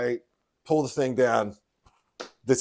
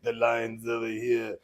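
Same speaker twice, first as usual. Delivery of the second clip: slow, lightly Russian clown line; a hint of a Russian accent, drawn out; lightly put-on Russian accent; mock Russian accent; 3 dB quieter, slower than usual. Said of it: "they all had Russian accents".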